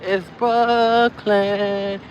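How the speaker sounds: Brooklyn stretched slow and high; proud slow Brooklyn. Pretend. Correcting herself, then proudly announcing Brooklyn slowly. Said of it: "Wait, wait, wait".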